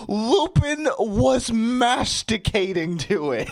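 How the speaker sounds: high, slow, breaking into giggles; real laughter. Real, not acted. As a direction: read through helpless laughing. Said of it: "that was a drink for laughing".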